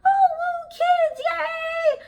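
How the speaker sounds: very high, squealing mock enthusiasm; mock a fake-cheerful teacher gushing at kids; hammed-up over-the-top excitement; fake peppy cheer. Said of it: "I don't".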